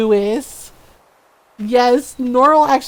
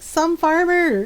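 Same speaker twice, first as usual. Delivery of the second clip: higher, drawn-out playful repeat; hammed-up, teasing delivery; mock self-announcement; 6 semitones higher, slower than usual. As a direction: stretch it out cheekily, voice lifted. Same retort repeated for effect.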